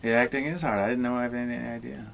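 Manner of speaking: ordinary delivery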